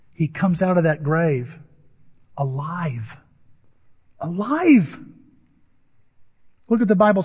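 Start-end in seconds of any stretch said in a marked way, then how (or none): 2.4-3.2 quiet. Real, not acted. First quiet statement of word. 4.2-5.0 exclaimed. Real, not acted. Preacher repeats word with force.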